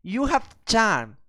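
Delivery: haughty city lady. Acted. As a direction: gush like a posh city lady.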